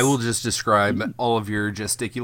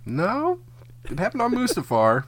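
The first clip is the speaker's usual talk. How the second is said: high mock-indignant voice; 10 semitones higher than usual. Mock-serious Star Wars correction.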